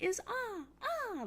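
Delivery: very high squeaky sing-song; high cutesy squeak; couple's silly voice. Retold or imitated. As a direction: squeal it like a baby. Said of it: "we'll go A".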